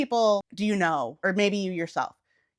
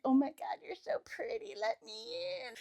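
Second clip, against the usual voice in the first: mock creepy stalker; 14 semitones higher, 12 dB quieter than usual. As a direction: coo at the window like a creep.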